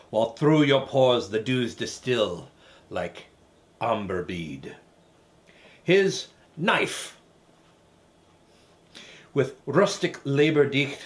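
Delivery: put-on Scottish recitation voice; Scottish accent. Reciting Burns's Address to a Haggis, theatrical Scottish accent. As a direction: declaim it in a movie-learned Scottish accent. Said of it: "do the whole thing in the Scottish accent".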